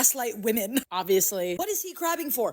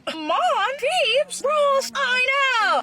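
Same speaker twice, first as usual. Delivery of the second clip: high wailing mock sob; a hammed-up tearful whine; mock crying; 12 semitones higher, much slower than usual. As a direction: pretend to cry at the screen. Reacting to clips, crying voice.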